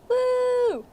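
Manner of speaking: high, happy whoop; excited cheer. Acted. Sock puppet cheering about finishing the craft, a high whoop.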